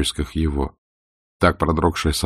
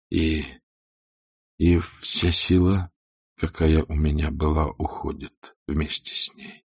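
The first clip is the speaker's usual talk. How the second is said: slow, fading, halting grief; voice breaking; 4 dB quieter, slower than usual. A narrator's reading. Ashley to Scarlett about his dying wife, voice breaking. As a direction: let the voice give way and fade out. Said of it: "Голос его сломался".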